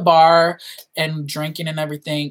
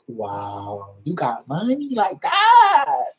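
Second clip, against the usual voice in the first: mock awe; 3 semitones higher, much slower than usual. Awed at boss paying.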